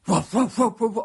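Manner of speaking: loud, repeated woofs; dog barking. A narrator's reading. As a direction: bark like a dog, in sharp bursts. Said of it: "so he started barking".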